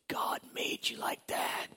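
slow, soft whisper; soft, slow, whispered reassurance; tender whisper. Retold or imitated. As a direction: whisper it gently, slowly. Tender word to the kid.

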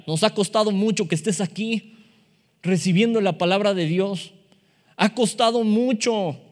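normal talking voice

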